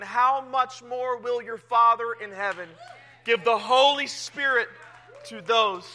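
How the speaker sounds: loud and slow, building to a peak; preaching crescendo. Acted. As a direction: drive home the promise. Climax of the scripture reading.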